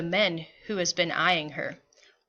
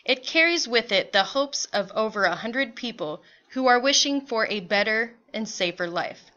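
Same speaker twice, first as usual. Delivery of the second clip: plain reading voice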